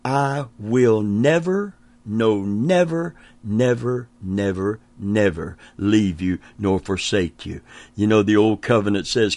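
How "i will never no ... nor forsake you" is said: building then fading insistence; repeated never. Shown as a demonstration. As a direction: pound out "never, no, never, never, never". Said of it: "to the fifth degree of never".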